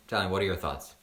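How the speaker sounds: normal talking voice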